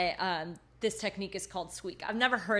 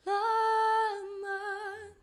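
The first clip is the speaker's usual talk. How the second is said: head voice; 9 semitones higher than usual. Shown as a demonstration. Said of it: "which is the whole thing on the head voice".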